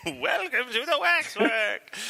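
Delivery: slow, high mock trailer voice through laughter; Jonathan's trailer impression. Host repeats co-host's Waxwork trailer impression, hammed-up and amused. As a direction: draw it out high, half laughing. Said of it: "hear Jonathan repeatedly go".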